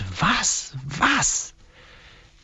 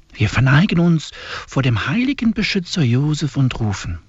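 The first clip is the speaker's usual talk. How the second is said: ordinary talk, nothing marked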